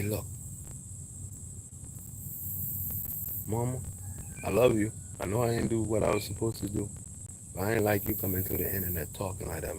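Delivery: tender talk to mom. Pretend. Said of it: "I'd say".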